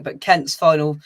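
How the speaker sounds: ordinary talk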